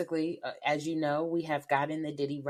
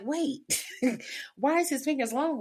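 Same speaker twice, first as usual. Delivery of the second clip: high-pitched baffled reaction, broken by laughter; amused disbelief; 9 semitones higher than usual. Retold or imitated. Retelling her reaction to the court sketches, laughing disbelief. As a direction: laugh through a puzzled double take.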